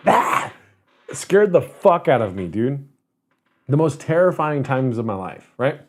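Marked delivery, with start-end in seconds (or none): 0.1-0.5 short, high "ah!" to startle someone; jump-scare shout. Retold or imitated. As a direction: mimic someone springing out from behind. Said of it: "came up from behind me and went".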